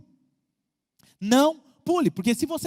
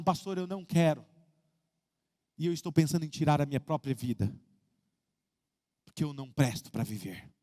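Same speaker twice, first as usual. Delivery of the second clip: dropping lower and softer, near silent by the end; retold despair; 8 semitones lower, 4 dB quieter than usual. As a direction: read the message like a crushed young man fading out. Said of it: "ele dizia desesperado, chorando". (um lamento desesperado que vai sumindo, baixo e suave)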